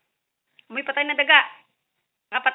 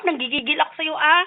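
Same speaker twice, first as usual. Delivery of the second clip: ordinary talk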